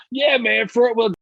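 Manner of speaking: high, carefree bro voice; an oblivious driver shrugging off complaints; dopey dude. Retold or imitated. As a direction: brush it off like a clueless bro. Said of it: "he would go".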